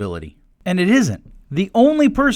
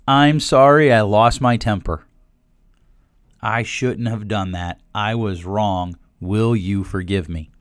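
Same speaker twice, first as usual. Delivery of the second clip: sincere apology; 8 semitones lower, slower than usual. Shown as a demonstration. Model sincere apology to kids.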